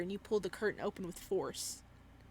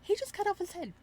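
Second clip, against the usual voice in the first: high shocked take; 11 semitones higher, 3 dB louder than usual. Shown as a demonstration. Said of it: "repeated in different voices".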